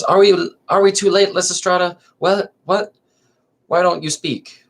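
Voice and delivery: the latecomer calls out, high and worried; high anxious Marina. Acted.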